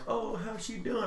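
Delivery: high, soft fake innocence; mock innocent voice. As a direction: play dumb in a high voice. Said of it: "And he's all like".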